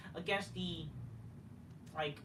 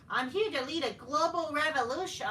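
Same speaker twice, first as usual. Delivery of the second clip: higher, sneering imitation of a pompous wrestler; mocking Mercedes Moné; 8 semitones higher, 5 dB louder than usual. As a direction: mimic her grand boast with contempt. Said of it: "Monet says".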